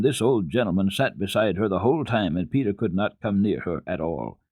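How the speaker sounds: plain reading voice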